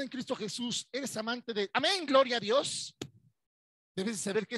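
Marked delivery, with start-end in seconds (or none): 1.7-2.9 mock fervent amen. Mimicking enthusiastic congregants.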